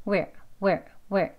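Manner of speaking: short "where" without a second beat; short single sound. Shown as a demonstration. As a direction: say "where" briefly as one sound. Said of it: "빨리 해서 그렇습니다".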